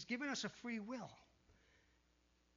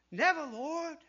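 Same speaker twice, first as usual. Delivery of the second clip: loud protest; 6 semitones higher, 10 dB louder than usual. Acted. Peter protests Jesus' coming death.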